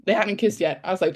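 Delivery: ordinary delivery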